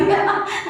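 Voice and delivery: loud high laugh; big real laugh. Real, not acted. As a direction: burst out laughing at your own joke. Laughs at own joke.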